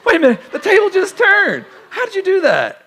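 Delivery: very high, loud squeal of disbelief; mock astonishment. Pastor reacting to a critic turning the tables, squealed mock disbelief.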